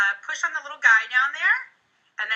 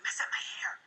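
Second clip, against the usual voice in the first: a soft whisper; whispered aside; 11 dB quieter than usual. Retold or imitated. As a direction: whisper the instruction to dad. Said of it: "I'll be like".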